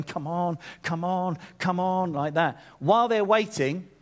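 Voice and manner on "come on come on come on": a hint of nervous impatience, "come on" repeated; impatient urging. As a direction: mildly hurry him along under the breath. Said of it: "Jairus is stood there going".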